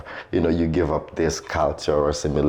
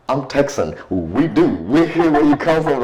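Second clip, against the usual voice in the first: louder, higher, playful Texan act; mock Texan retort; 9 semitones higher, 5 dB louder than usual. Storyteller imitating a Texan answering the offended man, loud and high. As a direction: belt it out as a proud Texan.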